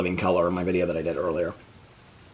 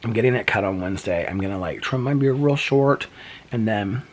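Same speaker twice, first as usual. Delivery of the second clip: ordinary talk, nothing marked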